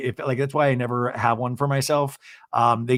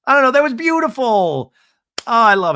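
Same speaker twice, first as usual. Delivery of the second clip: high, loud gush; 9 semitones higher, 7 dB louder than usual. Real, not acted. Vlogger reacting to tearful scene, loud gushing. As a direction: swoon over it out loud.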